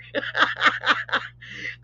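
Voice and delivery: breathy, mostly voiceless laugh; amused burst of airy laughter. Real, not acted. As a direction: laugh at your own joke. Laughs at own roving reporter remark.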